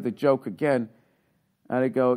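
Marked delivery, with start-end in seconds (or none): none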